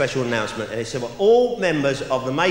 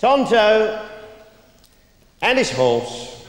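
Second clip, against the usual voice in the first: pompous announcer; 8 semitones higher, much slower than usual. Retold or imitated. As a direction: announce the name with pomp. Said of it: "and he's all pompous".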